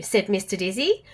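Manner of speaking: usual speaking voice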